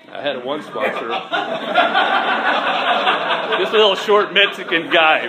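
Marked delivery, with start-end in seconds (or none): 1.1-3.5 high, loud burst of real laughter. Real, not acted. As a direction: laugh out hard at the memory. Laughs remembering his sponsor.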